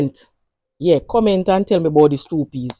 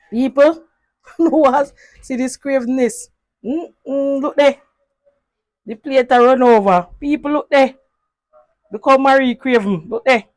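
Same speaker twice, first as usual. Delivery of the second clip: playful mock outrage; 7 semitones higher, 5 dB louder than usual. Mock-scolding Marie's greed to viewers.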